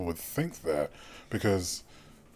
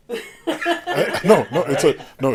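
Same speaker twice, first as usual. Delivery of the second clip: laughing, loud reply; 10 semitones higher, 8 dB louder, slower than usual. Real, not acted. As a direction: laugh and answer. Amused reply.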